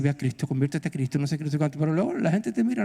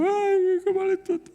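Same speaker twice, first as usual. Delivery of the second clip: much higher, whiny mock wail; mock crying. Preacher mocking a believer who sits crying, hammed-up wail. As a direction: imitate a crybaby moaning. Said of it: "No te quedes llorando".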